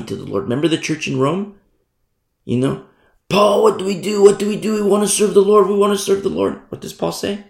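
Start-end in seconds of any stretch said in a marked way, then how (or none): 3.3-6.6 higher, eager and pressing; eager believers imitated, pitched up; eager pleading. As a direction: ask Paul what to do, all keen. Eager Christians asking Paul.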